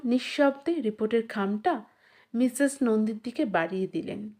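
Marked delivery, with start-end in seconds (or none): none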